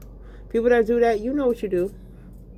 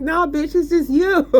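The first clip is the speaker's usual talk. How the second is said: high, louder mocking retort; imagined game voice mocking the player, higher and louder; sassy put-down; 10 semitones higher, 4 dB louder than usual. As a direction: snap back with sass. Game answers her scornfully.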